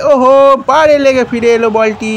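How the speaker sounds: ordinary talk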